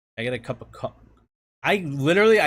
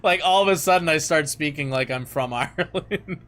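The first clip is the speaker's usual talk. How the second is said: laughing through the words; amused, breaking into laughter near the end; 2 semitones higher than usual. Real, not acted. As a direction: tell it while cracking up. Laughing about own Irish slip.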